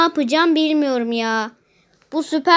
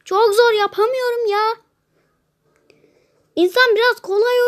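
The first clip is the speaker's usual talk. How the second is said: higher and louder exasperated whine; frustrated whining; 7 semitones higher, 5 dB louder than usual. Real, not acted. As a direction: complain to the game makers, fed up. Zor seviyeye isyan ediyor. (zor seviyeye sinirlenen oyuncu, yüksek sesle sızlanma)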